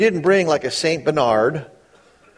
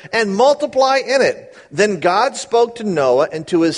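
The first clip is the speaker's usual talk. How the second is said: raised, measured Bible reading; scripture reading; 4 semitones higher than usual. Acted. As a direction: read the verses out with weight. Reads scripture aloud.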